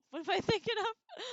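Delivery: high, loud laughing self-correction; giggling, baffled at yourself. Real, not acted. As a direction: laugh at your own mistake. Laughs at own name mix-up.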